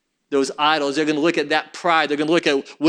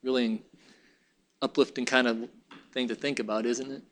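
low, soft and understated; subdued aside; 7 semitones lower, 10 dB quieter than usual. Real, not acted. Preacher to congregation after grim prophecy, subdued aside. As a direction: drop right down, almost to yourself.

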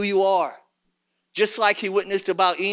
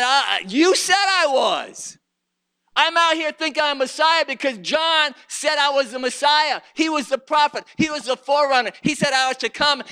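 raised, high-pitched complaint; indignant protest; 7 semitones higher than usual. Acted. Preacher voices Jesus let down by John, indignant.

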